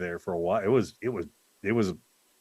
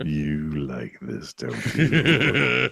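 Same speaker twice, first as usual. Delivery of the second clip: low slow mock-sultry tease; mock seductive; 5 semitones lower, much slower than usual. Teasing a friend about a mic, slow mock-sultry voice. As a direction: purr it slowly as a joke.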